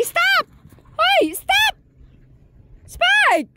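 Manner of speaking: high, loud "Stop!" at the dog; shouting stop. Real, not acted. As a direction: yell at him to stop right now. (malakas na sigaw na "Stop!")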